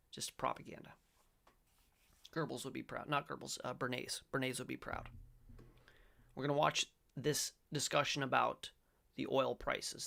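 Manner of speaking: ordinary talk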